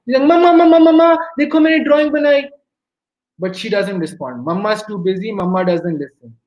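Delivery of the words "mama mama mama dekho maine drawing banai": put-on high, loud pestering kid voice; insistent child. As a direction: act a child clamouring for mum's attention. Child begging busy mother's attention.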